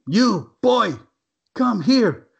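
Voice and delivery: loud, high, choppy order; choppy command. Retold or imitated. Foreman ordering a worker over, loud and choppy. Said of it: "real shoppy the way he's telling me".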